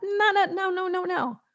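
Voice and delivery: high sing-song no no no; playful no. Real, not acted. Coach rejecting aimless Facebook Lives, high teasing refusal. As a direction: wag a finger with your voice.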